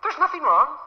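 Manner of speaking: high, clipped mock wife denying anything's wrong; a hammed-up sulky wife; mock wife brushing off her husband's question; huffy wife.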